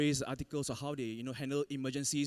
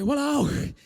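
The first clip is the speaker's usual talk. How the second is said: exasperated walao; 11 semitones higher, 10 dB louder than usual. Retold or imitated. Surfers paddle away, exasperated.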